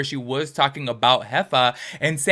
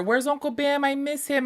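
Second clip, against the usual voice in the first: imitating a little girl, much higher and sweeter; 12 semitones higher, 3 dB quieter than usual. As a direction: voice the little daughter asking for her uncle, pitched way up. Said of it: "sending me videos of her daughter".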